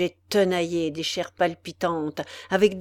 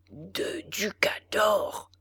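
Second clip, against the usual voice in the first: slow, soft whisper through clenched teeth; muttered between teeth; 6 dB quieter, much slower than usual. A narrator's reading. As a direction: grumble the price under your breath. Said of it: "répétait le bourreau entre ses dents".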